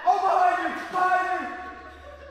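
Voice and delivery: very high, drawn-out cry of alarm; panicked warning. Real, not acted. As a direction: shriek a warning to a teammate. Warning teammate in zombie game.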